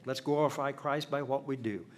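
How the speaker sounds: ordinary delivery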